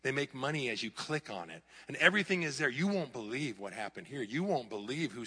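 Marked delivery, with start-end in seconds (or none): none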